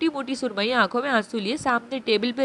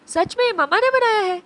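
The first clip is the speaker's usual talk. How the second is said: put-on high, louder little girl's gasp of wonder; amazed child; 9 semitones higher, 4 dB louder than usual. Abi asks if mother really made the pasta, amazed. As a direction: ask it eyes shining.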